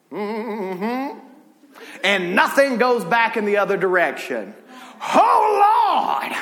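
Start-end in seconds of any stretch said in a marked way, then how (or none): 5.1-6.4 high, loud cry of Oh Lord; a sudden shout to the Lord. Real, not acted. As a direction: let it burst out of you, high and loud. Preacher overcome mid-sermon.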